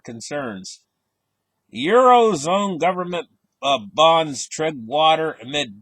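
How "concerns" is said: "concerns." said slowly, lower and softer with a falling end; emphasized word. Real, not acted. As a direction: lean on "concerns." as the key word. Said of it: "I'll emphasize that word".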